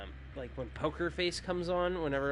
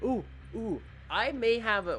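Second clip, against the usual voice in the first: excited; 8 semitones higher, 4 dB louder than usual. Real, not acted. Sudden idea for a game.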